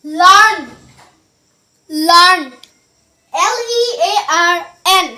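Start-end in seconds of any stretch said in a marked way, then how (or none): 0.1-2.4 লার্ন called out loudly, r dropped; model pronunciation. Shown as a demonstration. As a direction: announce লার্ন loud and clear. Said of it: "এটার সঠিক উচ্চারণ কি হবে?". (জোরে স্পষ্ট উচ্চারণে লার্ন)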